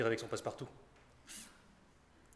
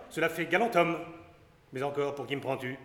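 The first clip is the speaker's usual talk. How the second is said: normal talking voice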